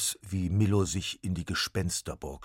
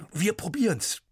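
put-on higher, louder boy calling out; eager call; 13 semitones higher, 8 dB louder than usual. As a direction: call it boldly as Max. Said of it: "rief er".

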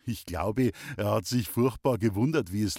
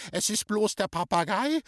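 high, incredulous echo rising into a question; outraged scream; 10 semitones higher than usual. A narrator's reading. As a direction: throw it back shrieking with outrage. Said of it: "hat geschrien". (empört und ungläubig nachgeschrien, hoch)

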